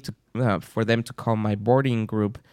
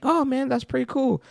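high-pitched retold appreciation; high, lightly acted approval; imitated pleased reaction; 12 semitones higher than usual. Retells man's pleased reaction to gift.

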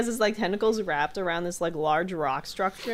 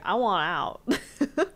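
slow deadpan; 2 semitones higher, much slower than usual. Retold or imitated. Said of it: "he's like".

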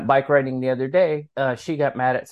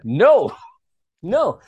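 firm refusal; 6 semitones higher, 6 dB louder than usual. Retold or imitated. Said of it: "And I was like".

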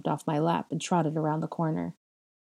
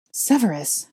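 higher, louder exclamation of a name; mother's urgent cry, read aloud; 4 semitones higher, 5 dB louder than usual. A narrator's reading. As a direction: call out in startled urgency. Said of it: "my mother cried".

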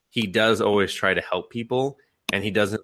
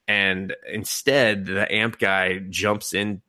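ordinary delivery

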